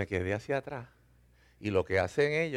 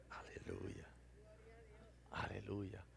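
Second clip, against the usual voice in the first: breathy laughing praise; 3 semitones lower, 23 dB quieter, much slower than usual. Real, not acted. Laughing, breathless praise after the ride.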